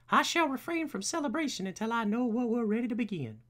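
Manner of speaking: high, put-on formal butler; Alfred. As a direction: announce it as a high-voiced butler. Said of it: "Anybody know what Alfred sounds like?".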